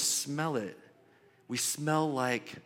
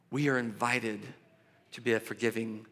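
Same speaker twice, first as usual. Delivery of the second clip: normal talking voice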